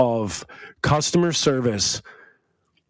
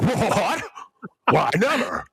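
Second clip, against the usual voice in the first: hammed-up indignant talking dog; dog's accent; 4 semitones higher than usual. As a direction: put on a higher, affronted dog voice. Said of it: "I like your dog's accent".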